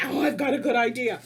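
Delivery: loud, bright burst of a sudden idea; excited idea. Pretend. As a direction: exclaim it like a brainwave for the kids. Excited new idea for class.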